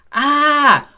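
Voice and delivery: sudden high, loud "ah" of realisation; a sharp mock "aha" as it clicks; realising gasp. Trader suddenly sees the downtrend.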